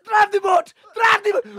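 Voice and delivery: high-pitched, hammed-up frantic shouting; mock panicked yell. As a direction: shriek an order in comic panic. Panicked prince yelling at skipper.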